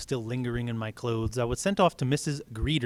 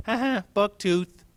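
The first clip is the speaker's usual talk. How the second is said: high, slow taunting laugh; bully taunting Jin; mocking kid laugh; 9 semitones higher, 7 dB louder, slower than usual. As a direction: sneer the nickname. Kids mocking.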